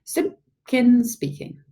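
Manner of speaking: father's grand telephone manner; self-important telephone voice. A narrator's reading. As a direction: answer as someone very important. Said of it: "in his very important telephone voice".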